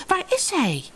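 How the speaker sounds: very high, urgent question; exclaiming. A narrator's reading. Sina searches for the shadow's owner, crying out high. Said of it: "riep Sina uit".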